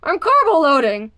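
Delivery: talking through a mouthful; mouth full. A narrator's reading. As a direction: say it round a bite of food. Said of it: "through a mouthful of pancake".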